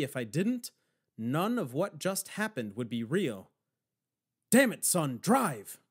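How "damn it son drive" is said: loud, forceful shouted order. A narrator's reading. Old man urging the driver to flee, shouting. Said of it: "Garland shouted".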